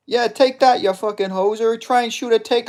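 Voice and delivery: loud, high-pitched Canadian trash talk; Albertan hoser. Acted. Gamer voicing his fighter mid-brawl, high, loud Canadian accent. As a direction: taunt like a hammed-up Canadian hoser. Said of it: "I'm doing that voice for this guy".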